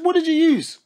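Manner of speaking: high, loud demanding mum; shocked mum. Retold or imitated. Imitating mum demanding what he used, high and loud. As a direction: demand it like an appalled mother. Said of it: "She said".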